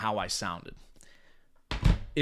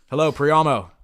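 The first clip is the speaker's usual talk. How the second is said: firm, louder, assured "Hello, Priyamo"; confident greeting; 4 semitones higher, 12 dB louder than usual. Shown as a demonstration. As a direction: own the call from the first word. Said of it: "I speak with confidence and conviction".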